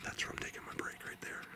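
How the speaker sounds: very soft, voiceless whisper; hushed whisper. Real, not acted. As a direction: whisper it as if keeping quiet in the woods. Quiet in the woods, claiming spot.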